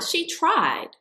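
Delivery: "she tried" pitched high and dismissive. Shown as a demonstration.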